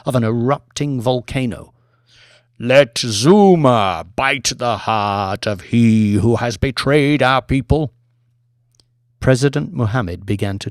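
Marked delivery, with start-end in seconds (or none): 2.6-7.9 slow, louder, deliberate curse; serpent curse. Acted. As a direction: lay the curse on the traitor slowly. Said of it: "A deep voice".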